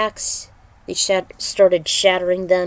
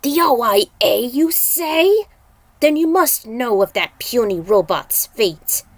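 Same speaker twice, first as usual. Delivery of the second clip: louder taunting demon voice; gruff demon; 3 semitones higher, 5 dB louder than usual. Acted. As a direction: sneer at them like a demon. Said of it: "in a gruffy tone".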